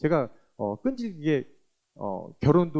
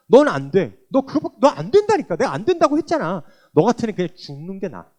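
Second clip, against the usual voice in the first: a scolding voice, higher and louder, half laughing; a mock sneer of self-condemnation; accusing inner voice; 4 semitones higher, 7 dB louder than usual. The guilt in her head berating her, mocking scold.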